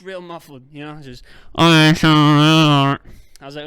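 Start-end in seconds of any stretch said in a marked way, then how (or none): 1.6-3.0 loud. Retold or imitated. Loud retold reply.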